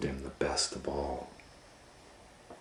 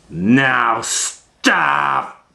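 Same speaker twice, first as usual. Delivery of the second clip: high, loud order; commanding shout; 10 semitones higher, 12 dB louder than usual. Acted. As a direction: bark the stop. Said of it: "said Max".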